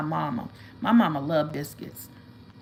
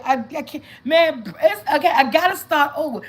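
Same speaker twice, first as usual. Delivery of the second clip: retold; 7 semitones higher, 7 dB louder than usual. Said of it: "I said".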